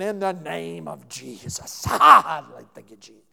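exhausted half-hearted prayer at dawn; tired prayer. Retold or imitated. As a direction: drag it out like you've had no sleep. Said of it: "You're not in your greatest form".

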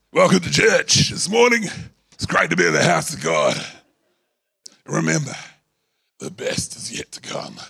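lightly hammed-up announcer, playing on a husky voice. Pastor jokes to the congregation with his husky voice, mock announcer. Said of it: "my voice has gone a little husky".

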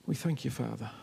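soft, low earnest prayer voice. Acted. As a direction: pray for the church, gently and low. Pastor leads congregation in prayer.

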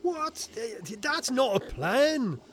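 high, loud, drawn-out protest; incredulous outburst. Acted. Inspector objects to inventor's plan, spluttering and incredulous. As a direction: splutter in disbelief, stretching the objection.

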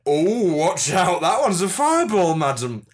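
loud, hammed-up wizard. Wizard showing off fireballs to a madam, loud and hammy. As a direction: show off spells at full voice. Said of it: "am a wizard".

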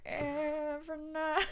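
dramatic ballad-singer drawl. Retold or imitated.

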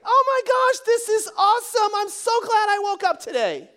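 high, loud mock elation; overjoyed squeal. Preacher imagines Mary thrilled at the angel's news, gushing excitedly. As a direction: gush at full volume, way up high.

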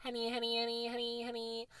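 ordinary delivery

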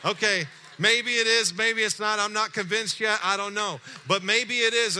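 high and loud, mock doubting; skeptical. Pastor doubting the caller's miracle, loud and wry. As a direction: toss off the doubt loudly with a grin. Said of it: "and I'm like".

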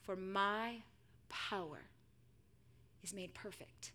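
hushed, measured reassurance; quiet, tender authority; gentle divine answer. Acted. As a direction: speak softly and low, leaving space between phrases. Voicing God's gentle answer to Paul.

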